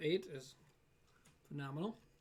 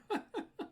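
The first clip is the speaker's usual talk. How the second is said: short high laugh. Real, not acted. Reacting to chat.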